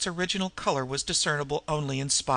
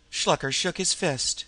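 ordinary delivery

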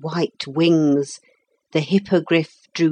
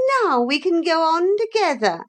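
hateful lady; 12 semitones higher than usual. A narrator's reading. Unwelcome motor lady greeting the children, high hateful voice. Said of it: "in that hateful voice of hers".

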